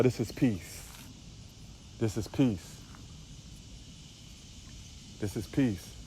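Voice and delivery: softly dwelling on the peace; hushed awe. Real, not acted. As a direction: let it sink to a near hush. Hushed savoring of the view.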